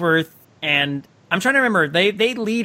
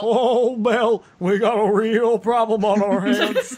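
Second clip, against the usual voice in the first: voicing the horse; 5 semitones higher, slower than usual. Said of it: "is like".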